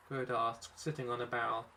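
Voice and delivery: ordinary delivery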